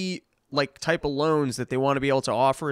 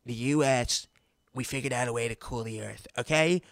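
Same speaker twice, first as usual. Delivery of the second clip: mock Trump; 3 semitones lower than usual. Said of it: "he goes".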